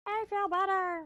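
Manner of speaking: high squeaky cartoon voice; silly high voice. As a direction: squeak it out goofily. Repeat in silly voice.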